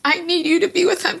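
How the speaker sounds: high, earnest retold plea; tearful pleading. Mother asking her husband to go be with the babies, pleading. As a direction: implore someone, voice lifted and close to tears.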